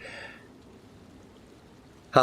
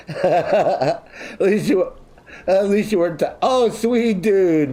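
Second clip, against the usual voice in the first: slow, high, laughing through words; 8 semitones higher, slower than usual. Real, not acted. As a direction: talk through the giggles. Laughing while talking to chat.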